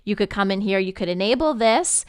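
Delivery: usual speaking voice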